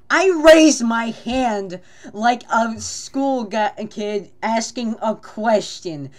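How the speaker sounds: high, loud, slow emphasis; loud, stretched-out comic dismay; exasperated retelling. Retold or imitated. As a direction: wail out the embarrassing detail. Exaggerated telling of embarrassing moment.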